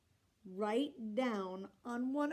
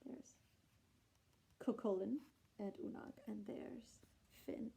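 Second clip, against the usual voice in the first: soft aside; 9 dB quieter than usual. Real, not acted. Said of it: "pointing at pictures quietly".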